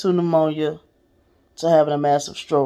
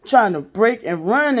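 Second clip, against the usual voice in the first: ordinary talk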